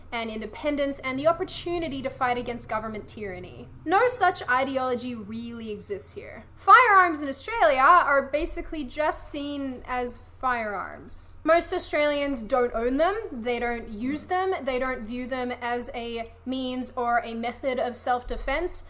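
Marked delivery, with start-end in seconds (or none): none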